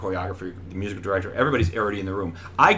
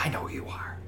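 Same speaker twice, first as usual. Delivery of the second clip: breathless whisper, barely voiced; awed whisper; 3 semitones higher, 9 dB quieter than usual. Starstruck actor answering the famous director, whispered. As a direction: hush it out in awe. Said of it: "I was like".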